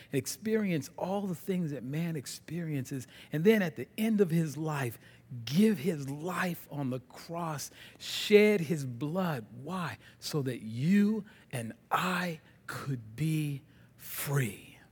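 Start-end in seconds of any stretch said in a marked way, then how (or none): 5.4-14.8 very slow, sinking to a whisper; slow solemn emphasis. Real, not acted. Preacher describing the cross, slow and hushed. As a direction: weigh each word, then whisper.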